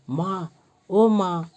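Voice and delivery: slow, groaning cry for mother; loud groan.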